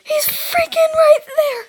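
high terrified yell; panicked shout. Real, not acted. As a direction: shriek it in panic. Scared by figure in video.

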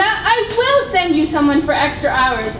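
ordinary talk

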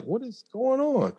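high, bewildered what-is-going-on; sudden puzzled squeak of confusion; confused surprise. Retold or imitated. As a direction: caught off guard and baffled.

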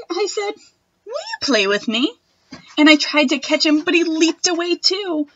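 put-on expressive read-aloud for children; reading a picture book to a class; storybook reading. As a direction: read it like story time for little kids.

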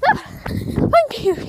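breathy relief after running; out of breath. Real, not acted. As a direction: gasp it out, winded. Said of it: "out of breath".

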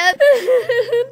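squealing laugh that breaks into a cry; high, teary squeal of laughter; high burst of laughter. Real, not acted. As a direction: crack up helplessly despite saying it's not funny. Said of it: "No me hace gracia".

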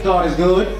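normal talking voice

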